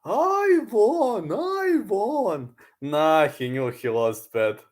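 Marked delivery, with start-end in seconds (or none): 0.0-2.5 high put-on voice chanting I won; mock the boastful fighter; Charlie Zelenov impression. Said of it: "like Charlie Zelenov".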